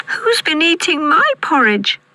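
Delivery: put-on very high baby bear squeak; tiny squeaky voice. A narrator's reading. Baby Bear finding his porridge touched, tiny squeak. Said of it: "squeaked Baby Bear in his tiny voice".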